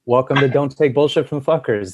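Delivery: usual speaking voice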